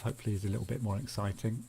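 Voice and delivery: ordinary talk, nothing marked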